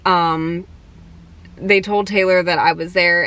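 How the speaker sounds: ordinary talk, nothing marked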